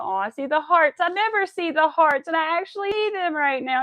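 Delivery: thrilled to finally see the hearts; excited. Real, not acted. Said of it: "That makes me happy".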